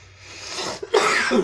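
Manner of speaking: sharp, high coughing fit; hard cough. Real, not acted. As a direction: cough hard, caught off guard.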